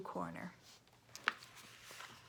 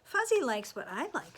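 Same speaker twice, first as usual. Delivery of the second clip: Owen's voice; 4 semitones higher, 7 dB louder than usual. Acted. Child Owen voiced in story.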